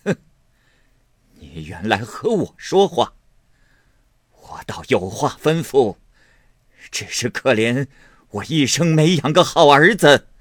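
rising to a loud, high angry outburst; an old matriarch scolding her son, building to a shout; stern rebuke. A narrator's reading. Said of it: "厉声说道".

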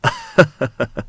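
short high knowing laugh, falling away; amused little laugh. A narrator's reading. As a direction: laugh before the question. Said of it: "笑着说".

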